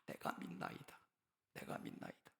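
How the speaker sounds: whispered echo of the plea; whispered, fading plea; whispered cry. Acted. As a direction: breathe it out, unvoiced. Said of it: "어떤 소리를 질렀습니까?".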